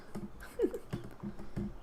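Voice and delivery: low, breathy chuckle; a quiet laugh at own bad luck; soft rueful laugh. Real, not acted. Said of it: "laugh after cake is eaten".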